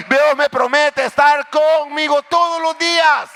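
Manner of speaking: loud, impassioned preacher; full-force sermon; fervent preaching. Acted.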